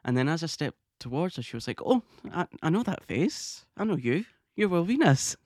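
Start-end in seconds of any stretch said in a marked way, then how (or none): 1.8-5.4 high, loud imitation of a delighted greeting; delighted recognition. Emma greeting a fan whose face she knows, delighted. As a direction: rise into a louder, higher exclamation. Voices idol recognising her on sight.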